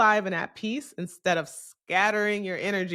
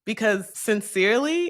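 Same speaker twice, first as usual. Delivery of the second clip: ordinary delivery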